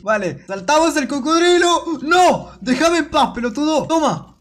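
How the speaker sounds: high-pitched frantic cries; real gamer panic, pitched high; panicked yelling. Real, not acted. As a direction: yell to fend off an attacker. Attacked by crocodile in game.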